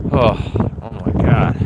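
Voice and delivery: ordinary delivery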